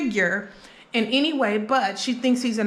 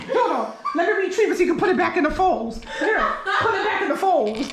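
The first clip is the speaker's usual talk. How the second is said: laughing; 6 semitones higher, faster than usual. Real, not acted. Fixing dropped sausage, laughing.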